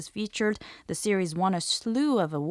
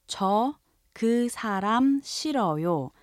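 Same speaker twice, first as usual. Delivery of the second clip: 저 그 사람 싫어요 said slowly, word by word; 천천히 또박또박; slow model; 3 semitones higher than usual. Shown as a demonstration. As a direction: spell out 저 그 사람 싫어요 for learners. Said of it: "So if you say".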